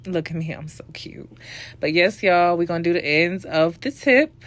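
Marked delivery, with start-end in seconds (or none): 0.1-1.3 soft whisper, teasing herself; whispered aside. Real, not acted. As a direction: breathe it as a playful secret.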